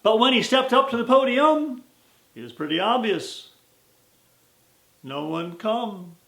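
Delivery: rhythmic storytelling cowboy verse; poem recitation. A narrator's reading.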